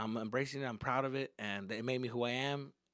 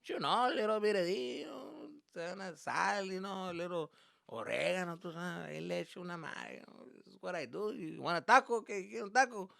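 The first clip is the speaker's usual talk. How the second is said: higher-pitched imitation of a chatty dad; dad's Spanglish patter; 6 semitones higher than usual. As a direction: play dad casually sharing his recipe. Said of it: "my dad's like".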